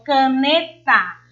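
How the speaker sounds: caneta with NE lifted and stronger; ca-NE-ta, the middle syllable emphasized; stress on the middle syllable. Shown as a demonstration. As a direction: raise the voice on the NE of caneta. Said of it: "elevo mais a minha voz na sílaba né".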